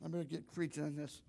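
usual speaking voice

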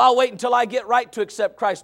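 ordinary delivery

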